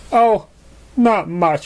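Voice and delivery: hide the hurt behind a breezy reply; forced casual. Acted. He replied.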